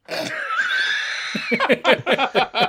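high shrieking burst of laughter; high squealing laugh. Real, not acted. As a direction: let a big squealing laugh out. Big laugh at own joke.

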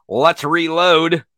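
normal talking voice

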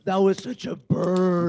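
delighted burn reaction. Real, not acted. Co-host savouring a burn, drawn-out exclamation.

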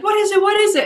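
high, urgent imitated alarm; mock panic. Roommate asking about the scare, high mock panic.

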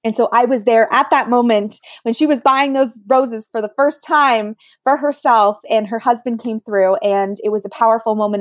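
normal talking voice

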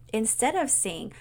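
ordinary delivery

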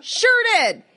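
telling off the groper, defiantly; mock defiant. To the groping host after biting him, defiantly.

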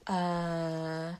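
drawn-out low, blank "uh"; imitating someone stumped by slang; puzzled response. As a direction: hold a low, clueless hesitation. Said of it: "People are like".

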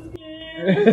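put-on high, small child's voice with a laugh; little scared kid. Child in bed calling out to mom, high and scared. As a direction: play your younger self, frightened.

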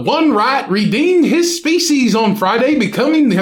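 hammed-up British news reading; amateur British accent, a bit Australian; mock British accent. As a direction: read it out in a put-on British accent. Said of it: "you sound like Nick Frost".

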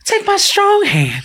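very high, slow put-on voice; creepy character voice. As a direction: stretch it out in a high mocking squeal. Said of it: "the way you're doing that voice".

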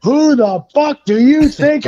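high-pitched angry tirade; imitated mad cop. Cop berating a driver he pulled over, imitated anger. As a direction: chew out the driver like a furious officer. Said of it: "He is so mad".

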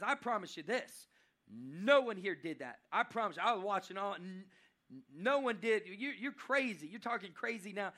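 loud, insistent denial; emphatic protest. Acted. Judah swearing to the warriors nobody took it, loud and emphatic.

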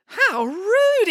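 high-pitched outraged exclamation; indignant exclamation. A narrator's reading. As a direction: exclaim in shock at rudeness. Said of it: "exclaimed Samantha".

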